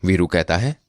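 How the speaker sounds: ordinary delivery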